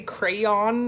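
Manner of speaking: "crayon" fully enunciated as cray-on, two syllables. Shown as a demonstration. Asking viewers how they say it, over-enunciated two-syllable crayon. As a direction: carefully sound out both halves of "crayon": CRAY-ON. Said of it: "really enunciate it".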